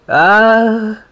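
high, loud jeering ah; mocking ah. A narrator's reading. Children jeering at the fooled girl, loud and high. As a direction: crow over the trick.

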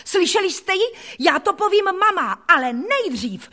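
put-on very high, loud, furious boy; shrieked bully's tirade; enraged yelling. A narrator's reading. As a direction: shout in outrage, threatening to tell. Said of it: "zařval".

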